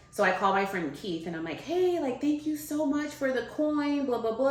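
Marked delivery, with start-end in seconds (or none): 1.6-4.5 high-pitched retold gushing; gushing thanks. Calling a friend to thank him, gushing high. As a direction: gush your thanks, pitched well up.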